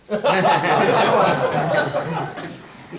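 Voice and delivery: loud burst of real laughter. Real, not acted. Speaker laughs with the audience at his daughter's story, loud real laugh. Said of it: "we're laughing about this stuff".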